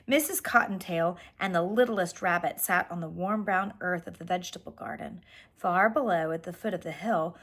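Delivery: plain reading voice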